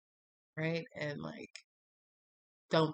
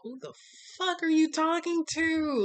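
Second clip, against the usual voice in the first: high, louder outraged "fuck are you talking to"; indignant; 12 semitones higher, 6 dB louder than usual. Retold or imitated. High, loud outrage at the guy ghosting her. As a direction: blow up at him. Confronting him over the messages.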